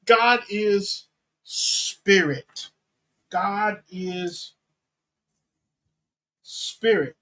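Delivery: long pause before "spirit", which falls; emphasis on SPIRIT. Shown as a demonstration. Preacher restating what Jesus is saying, weighty pause before "spirit". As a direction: hold after "God is", then place "spirit" alone. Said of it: "the emphasis is on God is spirit".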